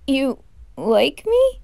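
ordinary delivery

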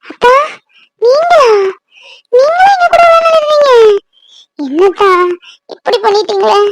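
high, tearful accusation; crying. Acted. Hurt sister demands he never leave them, crying. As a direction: plead and accuse through tears, pitched high. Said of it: "அழாத திவ்யா".

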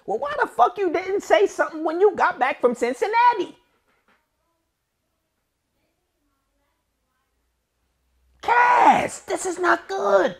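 very high, loud incredulous scold; shrieking frustration at a friend; exasperated scolding. Real, not acted. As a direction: let him have it in disbelief. Scolding Cassidy over silence.

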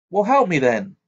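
insistent. A narrator's reading. Harry challenging her.

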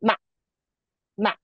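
MA very short and unstressed; neutral tone. Shown as a demonstration. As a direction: say MA briefly, without holding it. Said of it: "we have the neutral tone".

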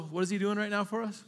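ordinary delivery

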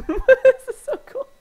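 high, slow laughing words; squeaking through a laugh. Real, not acted. Still laughing after fish bit.

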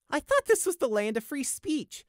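high, loud mock innocence; sarcastic high-pitched protest; shrugging retort. A narrator's reading. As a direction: toss it off in a lifted, cheeky voice. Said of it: "responded with a shrug".

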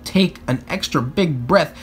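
ordinary delivery

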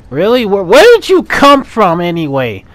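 yelling high and loud in outrage; furious yell. Real, not acted. Player ambushed by the monster, yelling in fury. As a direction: blow up at the game.

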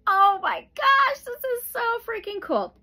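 high, loud gush of delight; giddy excited gush; thrilled. Real, not acted. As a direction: squeal it like you can't believe your luck. Thrilled by a matching card.

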